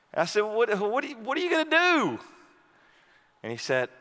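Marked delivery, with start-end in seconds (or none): 0.4-2.2 very high, stumbling called question; calling after a friend on the hill, high; retold holler. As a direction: shout it, tripping over the words.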